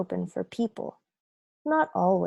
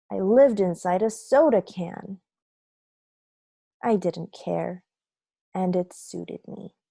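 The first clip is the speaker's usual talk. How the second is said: ordinary delivery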